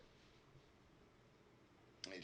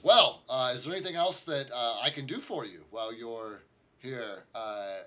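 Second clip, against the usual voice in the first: higher, louder eager offer; eager lord offering more help, higher and louder; excited lord; 4 semitones higher, 11 dB louder than usual. Acted. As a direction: keep the thrill going as you ask. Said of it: "he's obviously very excited".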